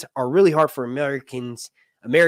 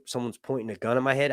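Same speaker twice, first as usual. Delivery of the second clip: normal talking voice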